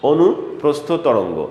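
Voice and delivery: অনুপ্রস্থ তরঙ্গ repeated slowly and evenly. Shown as a demonstration. Teacher repeating the term slowly for notes. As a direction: say অনুপ্রস্থ তরঙ্গ again, drawn out. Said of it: "এই জন্য তাকে আমরা বলে থাকি".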